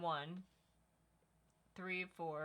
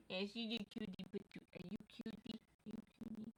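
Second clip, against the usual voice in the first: high, soft cooing voice; 3 semitones higher, 6 dB quieter than usual. Real, not acted. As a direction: coo at the puppy. Cooing to the dog.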